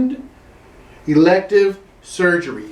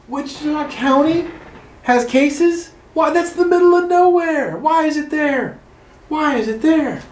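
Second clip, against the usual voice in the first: hammed-up disbelief, much higher; mock astonishment; 9 semitones higher than usual. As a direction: act scandalized like a small-town gossip. Mock shock at rural cases.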